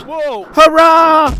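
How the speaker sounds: very high, triumphant whoop; gleeful cheer. A narrator's reading. Thomas cheers as his trick works, high gleeful whoop. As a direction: cheer at full pitch, loud on hurrah. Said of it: "laughed Thomas".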